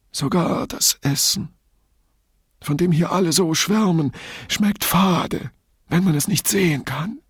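high, plaintive long-suffering man; resigned martyr, pitched well up; reluctant complainer. A narrator's reading. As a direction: complain as if it pains you to say it. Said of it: "dass er dies nur ungern aussprach".

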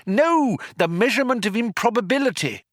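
high, insistent correction; acted impatient retort; exasperated correction. A narrator's reading.

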